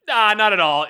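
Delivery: emphatic dismissal. Retold or imitated. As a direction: brush it off loudly. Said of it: "I was like".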